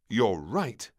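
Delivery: announcing doctor. Acted. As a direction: announce it finally. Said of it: "the doctor announced finally".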